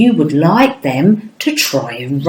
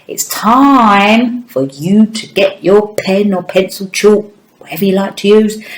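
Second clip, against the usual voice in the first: ordinary delivery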